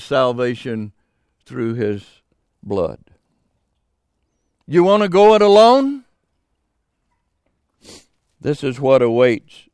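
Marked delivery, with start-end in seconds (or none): 4.7-6.0 challenging. Real, not acted. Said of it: "challenging sinners".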